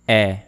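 E said as open "eh", as in bet; French open è. Shown as a demonstration. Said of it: "It is pronounced like this".

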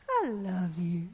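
God's low tender reply. Retold or imitated. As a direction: answer gently from deep down. God answers low.

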